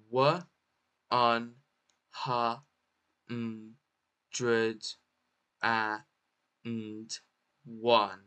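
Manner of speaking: "Wa un ha n dred and one" in isolated sounds; sounded out. Shown as a demonstration. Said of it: "How to pronounce this word?".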